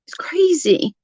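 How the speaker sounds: hushed, breathy aside; whisper. Real, not acted. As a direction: whisper it to yourself, high. Aside while reading comments.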